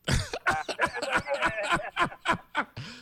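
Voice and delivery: high, hearty burst of laughter; big laugh. Real, not acted. Host cracking up at his friend's new phrase, hearty laugh. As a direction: let a real laugh burst out.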